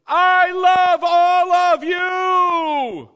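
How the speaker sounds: huge, slow, high proclamation through a bullhorn; drawn-out megaphone shout; booming shout. Pretend. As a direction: announce it to the whole planet at full blast. God with a bullhorn, mock proclamation.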